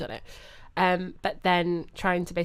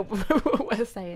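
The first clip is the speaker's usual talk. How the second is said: said through a laugh, slowly; much slower than usual. Real, not acted. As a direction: laugh your way through the words. Joking about cows understanding us.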